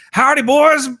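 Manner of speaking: retelling a Lego Movie scene, loud mock western shout; mock cowgirl. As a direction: holler like a fake cowgirl. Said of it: "she's like".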